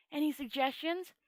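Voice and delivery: usual speaking voice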